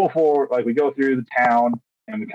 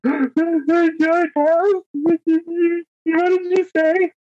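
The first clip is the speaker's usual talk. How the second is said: very high, slow, drawn-out disbelieving squeak; a gasp into high, stretched-out disbelief; stunned disbelief; much slower than usual. Retold or imitated. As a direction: act floored by the news that you passed.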